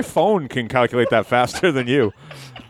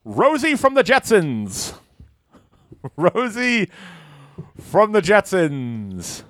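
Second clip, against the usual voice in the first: award announcer; 5 semitones higher, slower than usual. Acted.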